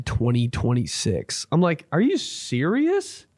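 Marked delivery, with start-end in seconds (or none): 1.9-3.3 high, drawn-out disbelief; retold squeak of disbelief; incredulous question. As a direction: stretch it and swoop way up. Acted reaction to endless wait.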